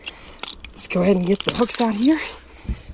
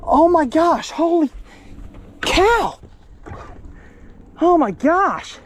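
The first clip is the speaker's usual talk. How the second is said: amazed; 10 semitones higher, slower than usual. Real, not acted. Landing a giant fish.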